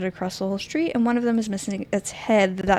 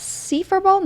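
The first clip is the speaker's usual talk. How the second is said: squeaky call to the furball; playful high pet voice; high teasing cat talk; 9 semitones higher, slower than usual. Real, not acted.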